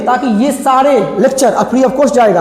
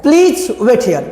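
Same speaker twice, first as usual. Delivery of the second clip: slow request; much slower than usual. Shown as a demonstration. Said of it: "request करेंगे तो कैसे करेंगे".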